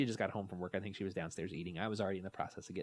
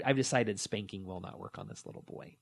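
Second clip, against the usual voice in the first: normal talking voice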